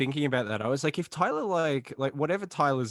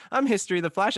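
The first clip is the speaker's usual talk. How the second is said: higher-pitched mock podcast intro; J D's intro; 7 semitones higher, 4 dB louder, faster than usual. Co-host mimicking the show's host introducing himself, mock intro. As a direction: imitate a host announcing himself. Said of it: "It's J D going".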